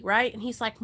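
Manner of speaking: ordinary talk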